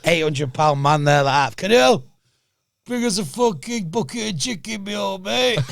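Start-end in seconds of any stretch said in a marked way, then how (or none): 1.6-5.6 high put-on voice, wheedling for chicken; hammed-up greedy big man; mock fat man voice. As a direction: beg for a bucket of chicken in a silly high voice. Voicing an 800 lb man.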